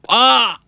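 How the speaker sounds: high, loud startled "Aa!"; sudden cry of alarm; startled yell. Real, not acted. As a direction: yell out in shock. Startled by mishap in game.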